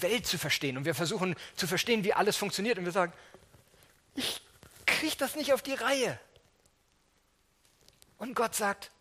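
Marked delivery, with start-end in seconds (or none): 4.1-6.2 mock despairing whine. Our despairing words to God.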